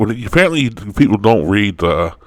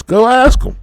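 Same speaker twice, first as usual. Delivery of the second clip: exasperated. Real, not acted. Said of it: "exasperated command".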